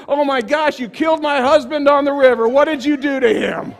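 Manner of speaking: lightly voiced accusing wife, loud; a hint of a shocked, blaming wife; imagined wife. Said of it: "What am I gonna say to Sherry?".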